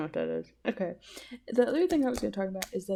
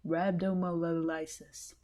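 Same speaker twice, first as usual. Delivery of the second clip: 1 sounding it out; 3 semitones lower, 4 dB quieter, slower than usual. Shown as a demonstration. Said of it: "What is that word?".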